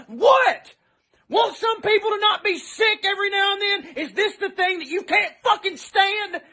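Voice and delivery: high, outraged questioning; exasperated shouted challenge; incredulous anger. Real, not acted. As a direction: burst out in real disbelief. Incredulous angry questions to rioters.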